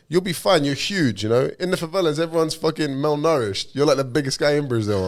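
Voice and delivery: imitating a friend's upbeat reassurance; breezy reassurance. Friend's reassurance acted, voice raised.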